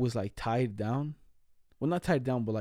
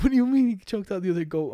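higher, louder incredulous question; disbelieving dad; 7 semitones higher, 6 dB louder than usual. Retold or imitated. Dad on the phone, hearing the goat news, shocked and loud. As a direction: throw it back in shock.

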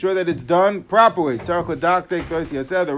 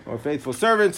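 ordinary talk, nothing marked

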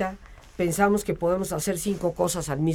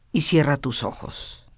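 guided meditation; 4 semitones lower than usual. Acted. Leads a guided relaxation.

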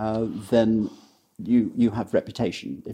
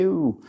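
mock ooh; 8 semitones higher than usual. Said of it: "he would say".

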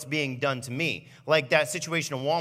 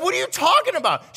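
high, loud baffled protest; a playful hammed-up outrage; indignant disbelief; 14 semitones higher, 7 dB louder than usual. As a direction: act truly thrown by her. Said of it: "I was like".

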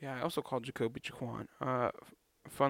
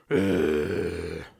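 loud, low sigh into the mic; heavy breathy sigh, close up; sigh right in your ear; 8 semitones lower, 8 dB louder than usual. Real, not acted. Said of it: "Sorry for doing all that. Right in your ears".

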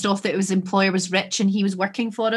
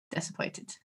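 hushed, very soft letdown; deflated disappointment; 4 semitones higher, 11 dB quieter than usual. Acted. Horror fan let down by the show, hushed and deflated. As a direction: drop to a near-whisper, crestfallen. Deflated verdict after acted excitement.

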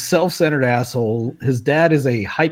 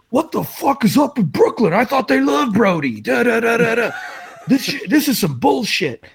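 high, hammed-up celebrity rant; mock Broner rant; 9 semitones higher than usual. To hotel security, a mocking high celebrity tantrum. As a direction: whine like an entitled star caught out. Broner ranting about complaint.

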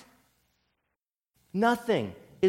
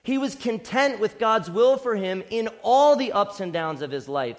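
louder, building sermon climax; preaching at full force; 3 semitones higher, 5 dB louder than usual. Acted. Preacher urging congregation toward Christ, rising passion. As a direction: press it home with mounting fervour.

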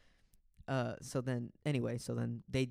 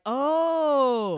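loud, high, drawn-out OH; excited squeal; 11 semitones higher, 14 dB louder than usual. Retold or imitated. Friend hearing this is Ricky, excited drawn-out oh. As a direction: imitate an over-excited girl's long whoop. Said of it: "Like, excited, like".

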